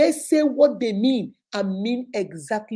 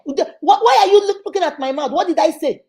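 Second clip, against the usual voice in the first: higher and louder, faking innocence; hammed-up who-me outrage; mock indignant protest; 7 semitones higher, 7 dB louder than usual. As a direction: caught gossip acting offended. Caught friend protests innocence.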